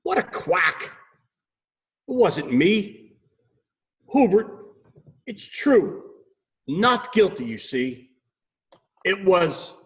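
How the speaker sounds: high, flustered duck voice. Acted. Duck pleading innocence to the owl. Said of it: "Debbie gasped".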